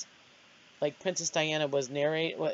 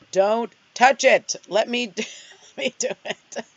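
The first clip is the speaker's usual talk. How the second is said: mock exasperation; 6 semitones higher, 8 dB louder than usual. Daughter takes over from her mother fumbling the website, mock exasperation.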